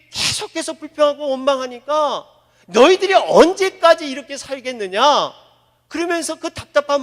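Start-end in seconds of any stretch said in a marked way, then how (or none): 2.7-5.0 loud exasperated scolding; angry rebuke. Acted. Moses losing his temper at the grumbling Israelites, loud rebuke. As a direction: snap at the complaining people.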